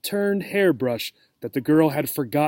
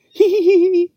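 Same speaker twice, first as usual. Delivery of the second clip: shrill giggles; 7 dB louder than usual. Acted. Cat giggles again.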